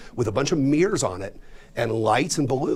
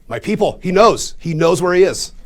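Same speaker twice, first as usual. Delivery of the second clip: excited; 6 semitones higher, 8 dB louder than usual. Retold or imitated. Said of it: "I'm like".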